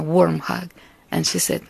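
usual speaking voice

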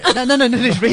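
rising loud no's; excited protest. Real, not acted. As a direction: protest laughing but loud. Loud protest over joke.

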